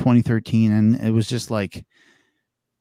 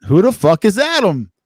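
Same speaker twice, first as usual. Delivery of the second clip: high, loud mock outrage; joking shout at Adam joining the stream; 9 semitones higher, 6 dB louder than usual. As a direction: yell it in jest at the newcomer. Teasing newcomer on stream.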